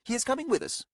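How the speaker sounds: high, firm pronouncement; firm woman voice. Acted. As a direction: state it with finality. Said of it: "Coria pronounced".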